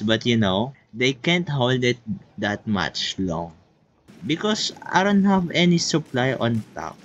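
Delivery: ordinary delivery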